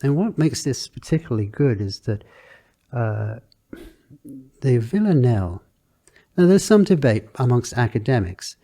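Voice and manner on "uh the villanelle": ordinary talk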